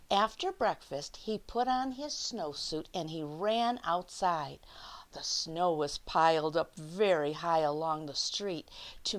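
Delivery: lively picture-book read-aloud; reading to children. Acted. Teacher reading The Snowy Day to her class, lively read-aloud. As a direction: read it out like story time for little kids.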